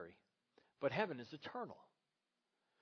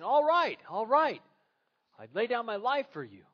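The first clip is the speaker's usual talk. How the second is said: giving in; 7 semitones higher, 10 dB louder than usual. Retold or imitated. Michael gives in exhausted.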